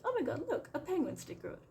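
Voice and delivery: high, squealing delight with a laugh; childlike excitement. Retold or imitated. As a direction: gush like a thrilled six-year-old. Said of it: "they become, like, a six-year-old again".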